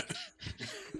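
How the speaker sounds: high squeal laugh. Real, not acted.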